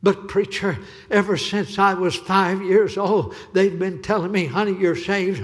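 loud, high, pleading, each "You're saved" hammered harder; girl crying out. Retold or imitated. Girl tells the preacher what she's been told, rising to a shout. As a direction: build from a plea to a cry. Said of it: "she looked up at me and said".

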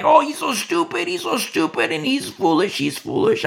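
mocking scoffer. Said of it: "People thought, like".

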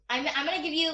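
usual speaking voice

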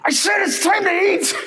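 high, shouted mock mom yell; hammed-up furious mom calling dinner; angry mom. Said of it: "now she's getting angry".

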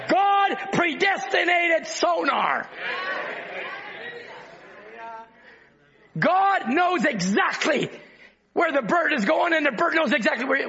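fervent pulpit cry building on each line; preaching. Acted. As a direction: preach it out to the congregation. Preaching at full force.

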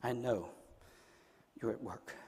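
very low, soft murmured prayer; hushed prayer. Shown as a demonstration. Preacher modelling a trusting prayer to the Lord, near whisper. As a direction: pray it privately, almost to yourself, with long pauses. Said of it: "You need to whisper that".

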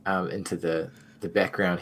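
ordinary delivery

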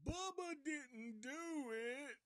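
very high, slow drawn-out wail; Bubba. Retold or imitated. Bubba giving himself away inside the scarecrow, high slow wail. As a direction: imitate the famous pleading line, high and stretched out. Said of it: "you hear the infamous".

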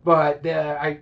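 ordinary talk, nothing marked

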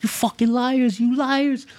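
high, squeaky mock-tantrum voice; manager flipping out. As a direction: imitate a little manager freaking out in a high pitch. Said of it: "is flipping out".

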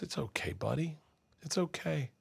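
put-on soft, high, comforting murmur to a baby; gentle soothing. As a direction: hush and reassure a crying child. Dad soothing crying baby.

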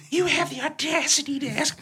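high, loud indignant rant; hammed-up outrage at a friend; mock fury, high and laughing. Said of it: "I'm like".

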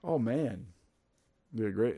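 ordinary delivery

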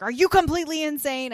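high, loud mock indignation; retold outrage. Podcaster voicing a man storming back downstairs, outraged. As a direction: act out an incredulous, outraged demand.

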